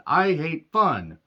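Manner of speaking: slow, sulky mock whine; mock whiny complaint. Mocking what critics of the gimmick sound like, slow and sulky. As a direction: drag it out like a sulky killjoy. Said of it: "That's what a lot of people will hear".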